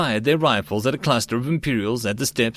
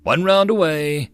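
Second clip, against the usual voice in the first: raised call of round away; yelling; 6 semitones higher, 4 dB louder than usual. A narrator's reading. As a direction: shout the rocket is fired. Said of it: "and he yelled".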